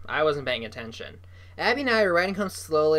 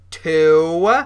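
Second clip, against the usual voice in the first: loud count at usual pitch; barked count; 12 dB louder than usual. A narrator's reading. Bully counts down at the sister, barking. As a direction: bark the number at full volume, pitch held down. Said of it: "Jasper Junior barked again".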